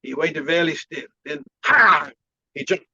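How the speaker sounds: put-on Chinese-accented master telling a little tale; halting imitation accent, bursting on the pounce; imitated Chinese accent. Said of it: "I like your Chinese accent".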